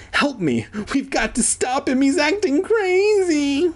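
high, escalating plea for help; hammed-up desperate cry; acted panic. A narrator's reading. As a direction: climb to a squeaky frantic peak. Acted plea while chasing the dog.

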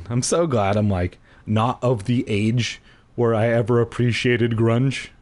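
plain reading voice

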